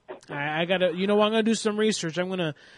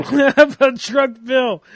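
loud, high and drawn out through laughter; laughing; 8 semitones higher, 10 dB louder, slower than usual. Real, not acted. Streamer repeats a viewer's joke name, laughing loudly. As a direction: crack up while repeating it.

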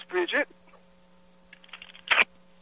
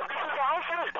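high, soft put-on voice for a prank call; prank caller voice; 8 dB quieter, slower than usual. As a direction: play a timid caller, pitched up and hushed. Prank caller names himself.

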